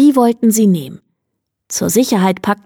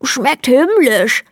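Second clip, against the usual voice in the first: higher, slow, muffled delight; mouth full; 5 semitones higher, slower than usual. A narrator's reading. Hare tells the pastor how it tastes, mouth full and higher. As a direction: chew the words out through stuffed cheeks. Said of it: "mit vollen Backen".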